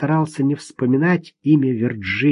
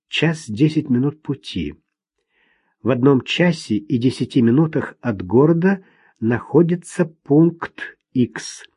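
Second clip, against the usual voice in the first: ordinary delivery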